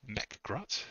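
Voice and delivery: Mechgrot tried out with a questioning rise; unsure pronunciation. Shown as a demonstration. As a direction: test the name Mechgrot softly, rising at the end. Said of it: "It's like Warhammer forty K".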